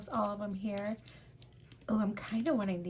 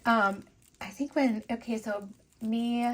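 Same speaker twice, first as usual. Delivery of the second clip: usual speaking voice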